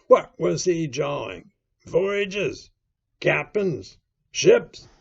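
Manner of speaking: loud, high, rapped-out questions; bullying interrogation. A narrator's reading. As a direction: badger him for an answer. Silver interrogates Morgan.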